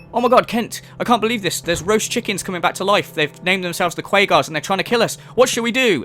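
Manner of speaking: high, urgent panicked companion; mock panic. Companion telling Kent about killer chickens, hammed-up panic. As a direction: babble in exaggerated alarm. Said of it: "you're like".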